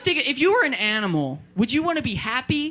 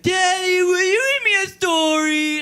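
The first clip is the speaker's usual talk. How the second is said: put-on high, loud crying child voice; high whiny kid voice; 10 semitones higher, 5 dB louder, slower than usual. Comedian mimics his child begging for a story in a high, loud whine. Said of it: "if my kid's like".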